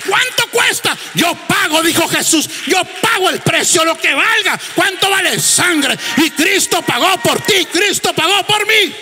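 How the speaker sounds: high, shouted preaching with a falling end on each question; preaching at full force. Acted. Preacher shouting to the congregation about the price of blood, full force. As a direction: cry it out to the congregation like a revival peak.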